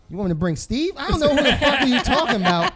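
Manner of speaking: incredulous, half-laughing rant; laughing mock outrage. Mock outraged reply to texter.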